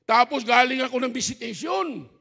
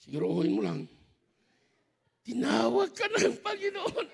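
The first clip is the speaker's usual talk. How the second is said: wife's words, laughing; 4 dB quieter than usual. Retold or imitated. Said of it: "sabi niya sa akin dito".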